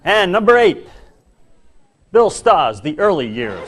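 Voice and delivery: big comic top-ten reveal; countdown announcer. Acted. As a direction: announce each entry with mock grandeur.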